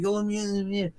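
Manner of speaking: soft, muffled mock pleading; retold plea. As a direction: imitate begging to get in, very quietly. Retold plea at car door.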